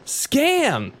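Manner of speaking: high outraged squeal. Acted. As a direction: shriek it in disbelief. Ranting about clinic scam.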